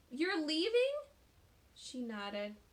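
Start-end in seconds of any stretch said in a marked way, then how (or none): 0.1-0.9 higher, louder "You're leaving?" rising hard; stunned boy hearing bad news; shocked question. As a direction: disbelief bursting out.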